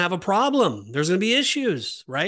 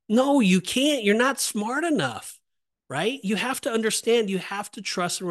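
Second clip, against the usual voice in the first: emphatic preaching; 7 semitones higher than usual. Real, not acted. Preacher driving a point home.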